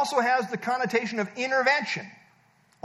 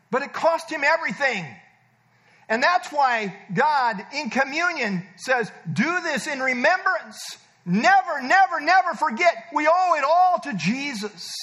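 raised, forceful pulpit voice; impassioned preaching; 4 semitones higher, 4 dB louder than usual. Real, not acted. Preacher urging believers to remember the cost, impassioned preaching. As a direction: preach it louder and higher, driving each phrase home.

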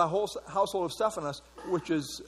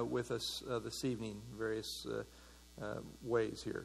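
quiet, low prayer voice, softer toward the end; 6 semitones lower, 4 dB quieter, faster than usual. Acted. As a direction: pray reverently to the Lord, murmuring the requests. Leads a prayer before study.

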